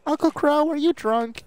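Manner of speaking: high, put-on cartoon niece voice; Ruby. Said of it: "I wish I could do Ruby's voice".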